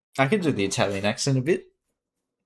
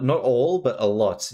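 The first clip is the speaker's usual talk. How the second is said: ordinary talk, nothing marked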